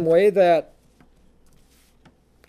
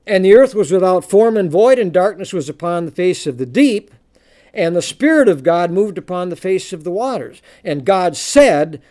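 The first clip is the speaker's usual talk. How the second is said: booming biblical narration; 6 dB louder than usual. Acted. Reads Genesis aloud.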